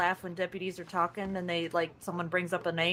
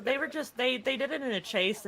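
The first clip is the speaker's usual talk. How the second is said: normal talking voice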